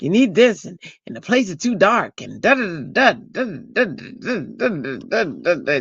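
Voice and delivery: high, sing-song rattled-off list; imitated mother. Daughter imitating mother on shopping spree, sing-song nagging. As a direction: mimic her going on and on in rising bursts. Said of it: "She's like".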